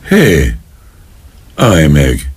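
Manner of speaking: usual speaking voice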